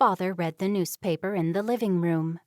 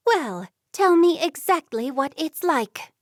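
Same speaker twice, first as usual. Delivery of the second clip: sharp, louder and higher demand; growing anger; 7 semitones higher, 5 dB louder than usual. A narrator's reading. Mother confronting daughter about secret notes, rising anger. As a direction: press her daughter hard for answers. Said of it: "Her mother was getting angrier".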